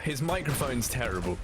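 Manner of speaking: ordinary delivery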